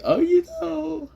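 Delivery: skeptical jab that cracks way up high. Acted. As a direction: push the doubt so hard the voice breaks. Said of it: "you hear that fucking voice crack?".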